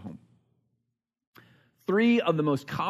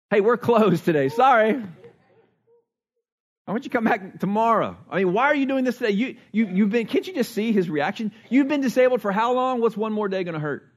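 higher, louder, mocking brush-off of a healed woman; indignant ruler mocked; 6 semitones higher, 3 dB louder than usual. Synagogue ruler scolds the crowd for healing on Sabbath, mock indignant. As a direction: sneer it out like a fussy official turning people away. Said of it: "That's literally what the guy's saying".

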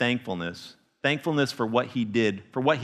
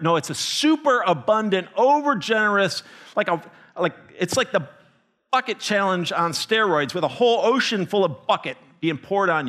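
building excitement; 6 semitones higher than usual. Real, not acted. Piling up words for grace.

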